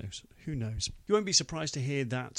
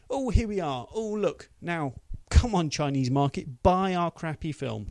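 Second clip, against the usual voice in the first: high, loud fake enthusiasm; hammed-up showy presenting, pushing the product; mock-eager hard sell; mock sales pitch; 5 semitones higher, 7 dB louder than usual. Voices film's cynical sales pitch.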